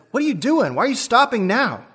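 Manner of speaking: high, puzzled question; baffled that the driver stopped on green; confused. Retold or imitated.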